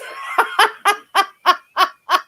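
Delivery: long high-pitched cackle; helpless peal of laughter; real cackle. Real, not acted. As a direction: laugh in a run of high bursts. Amused by viewer comment.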